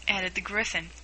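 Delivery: ordinary delivery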